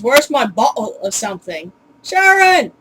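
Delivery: put-on Cockney accent with a glottal stop in bottle; imitated Cockney, building to a loud yell. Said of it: "who's got a Cockney accent".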